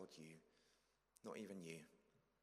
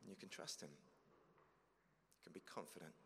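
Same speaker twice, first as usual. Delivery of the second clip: hushed reassurance; 4 semitones lower, 9 dB quieter, slower than usual. Real, not acted. Closing reassurance.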